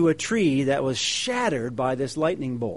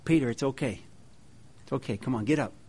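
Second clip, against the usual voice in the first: softer, reassuring Jesus; gentle comfort; 4 semitones lower, 10 dB quieter, much faster than usual. Acted. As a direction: soothe a frightened friend. Christ comforting Peter gently.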